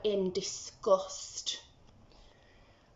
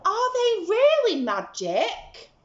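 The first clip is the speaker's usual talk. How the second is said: much higher, louder wonder; eager and wide-eyed; boy begging to know if beans are magic; excited Jack; 11 semitones higher, 7 dB louder, slower than usual. Acted.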